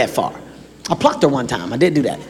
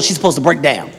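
normal talking voice

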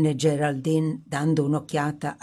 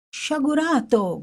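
high, drawn-out cry of dismay, slow; anguished cry; 9 semitones higher than usual. A narrator's reading. Young man crying out as the prince burns the banknotes. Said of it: "gridò".

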